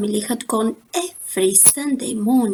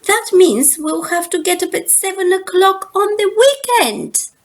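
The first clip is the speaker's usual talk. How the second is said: put-on whining kids; 8 semitones higher, 5 dB louder than usual. Kids protesting early rising.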